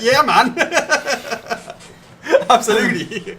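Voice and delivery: high, loud laughing reply; bursting into laughter mid-answer. Real, not acted. Laughing at his cohost's date mix-up, high and loud. Amused reply to Arno's mistake.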